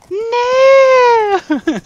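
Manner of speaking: very high, loud cry; anguished shout. Real, not acted. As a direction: wail at the failure. Crashing again.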